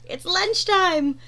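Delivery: plain reading voice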